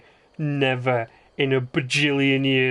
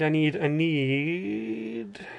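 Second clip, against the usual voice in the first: slow drawn-out mock shout; much slower than usual. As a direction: stretch "I need, I need" out very slowly in a strange voice. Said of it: "someone shouted at me".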